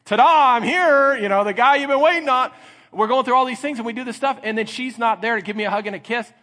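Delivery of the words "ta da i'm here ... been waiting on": high, loud ta-da entrance; showy announcement. Acted. Husband the wife has been waiting on, showily announcing himself. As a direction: make a hammed-up grand entrance.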